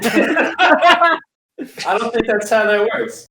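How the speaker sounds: amused objection through laughter; laughing. Real, not acted. Friend answers the joke about being called Junior, laughing.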